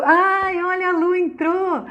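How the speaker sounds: high, delighted outburst; excited interruption. Real, not acted. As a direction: break off, voice leaping up at a friend's arrival. Interrupts herself as a friend joins. (interrupção empolgada, voz aguda)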